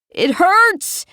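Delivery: high, sobbing cry of pain; a hurt boy wailing. A narrator's reading. Yang sobbing to the others that it hurts, high wail. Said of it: "Yang starts to sob".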